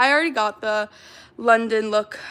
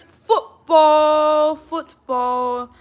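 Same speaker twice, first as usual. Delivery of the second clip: louder, a lightly posh British "Football"; mock British accent; 4 semitones higher, 8 dB louder, much slower than usual. Shown as a demonstration. Streamer shows chat the British word, mock British. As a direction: announce the word "Football" like a Brit. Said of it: "ready for this?".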